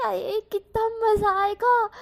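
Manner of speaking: giddy daydream, stretching out every word; gleeful excitement. A narrator's reading. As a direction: savour the delight out loud. Wing gleeful inner thoughts.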